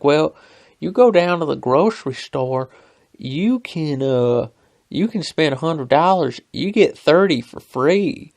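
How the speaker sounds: loud, high put-on Southern twang; punchy high twang, swooping on key words. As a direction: imitate a twangy coworker making his pitch. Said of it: "Alabama twang to him".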